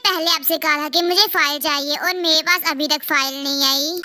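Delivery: ordinary talk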